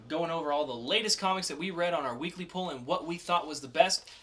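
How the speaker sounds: loud, upbeat host intro; podcast host opening the comic review; segment intro. Acted. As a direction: pitch the segment like a show host.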